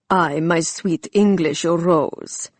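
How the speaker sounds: slow, hammed-up Turkish accent; mock villain in an overdone Turkish accent; hideous Turkish accent. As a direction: ham up a bad Turkish accent, savouring each word. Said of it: "adopting a hideous Turkish accent".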